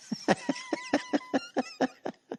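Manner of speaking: a high, sudden cackle breaking up the bit; high wheezing laugh; burst of laughter. Real, not acted.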